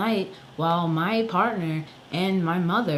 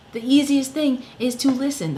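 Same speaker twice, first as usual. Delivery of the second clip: 1 exasperated; 8 semitones higher, 5 dB louder than usual. Real, not acted. Outraged rant at doctors.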